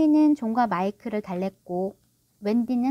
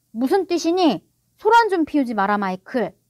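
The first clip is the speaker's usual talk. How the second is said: loud, raised pitch falling at the end; scolding father; 3 semitones higher, 6 dB louder than usual. A narrator's reading. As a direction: snap back in irritation. Father scolds the screaming boy.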